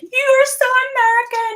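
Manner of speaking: high, drawn British mock outrage; mimicked friends' high-pitched teasing; imitated accusing friends. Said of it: "they're like".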